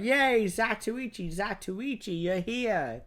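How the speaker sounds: high-pitched, drawn-out welcome cheer; hammed-up excited greeting; mock cheering crowd. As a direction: hail a returning hero like an adoring crowd. Townsfolk cheer the returning hero.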